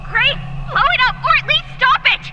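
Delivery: high, loud urgent command; urgent shout. Acted. Ally urging Grave over the radio, urgent high shout. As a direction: yell the order to wreck it. Urging the hero to destroy core.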